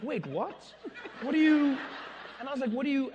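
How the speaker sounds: high, loud disbelieving sputter; stunned confusion. Retold or imitated. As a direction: sputter in shock.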